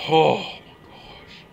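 slow, stunned whisper; shocked whisper on hearing news. Acted. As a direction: breathe it out in shock.

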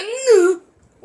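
loud, high startled gasp; startled cry. Real, not acted. Gamer caught out mid-match, loud startled gasp. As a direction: gasp loudly and sharply in sudden alarm. Sudden shock during the match.

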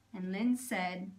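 ordinary delivery

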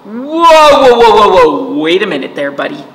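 loud alarmed whoas fading to a warning; alarmed Acorn. A narrator's reading. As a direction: stop the squirrel in a fluster. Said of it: "Acorn did not love that".

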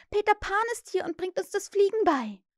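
high, eager announcement; excited calling. A narrator's reading. Wendy tells her sleepy brothers the news, excited calling. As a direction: call out the news, pitch high. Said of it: "rief sie".

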